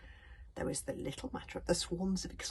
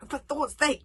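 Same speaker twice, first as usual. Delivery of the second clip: higher and louder, panicked outburst; terrified; 9 semitones higher, 10 dB louder than usual. A narrator's reading. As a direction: blurt it in rising panic. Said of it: "thought a terrified Hiccup".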